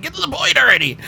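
high-pitched mock impatience; exasperated outburst. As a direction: squeal it like you can't wait any longer. Retold impatience at slow talkers.

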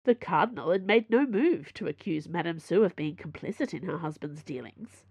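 ordinary delivery

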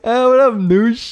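high, loud bro greeting; mock fan greeting. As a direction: call out like an eager fan. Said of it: "That's so much worse".

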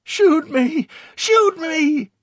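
high, loud, frantic begging; desperate pleading. A narrator's reading. Dazed Victor begging under fire, pleading. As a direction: plead at the top of your voice. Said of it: "Victor pleaded".

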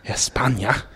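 put-on Spanish pronunciation; Spanish accent. Co-host echoing Spain, in a playful Spanish accent.

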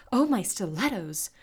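startled, loud and high; alarmed outburst; gasped exclamation. A narrator's reading. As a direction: exclaim in girlish shock. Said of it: "Crystal gasped".

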